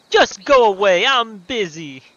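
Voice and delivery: loud, high Brooklyn griping; badly faked Brooklyn shout; bad Brooklyn accent. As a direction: shoo them off in a hammy Brooklyn accent. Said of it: "This is a terrible Brooklyn accent".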